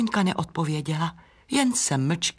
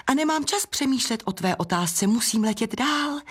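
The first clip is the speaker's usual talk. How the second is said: high-pitched excuse; evasive brush-off; 6 semitones higher than usual. Acted. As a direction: brush the question off lightly. Butterfly brushes off the question. (vyhýbavá výmluva)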